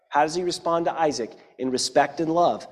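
usual speaking voice

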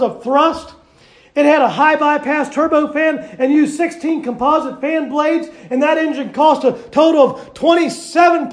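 loud, rousing pulpit preaching; preaching at full force. Acted. Preacher rallying the church behind Sister Barbara, loud and fervent. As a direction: whip the congregation up with every phrase.

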